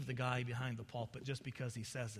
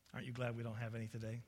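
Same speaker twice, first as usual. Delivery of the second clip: normal talking voice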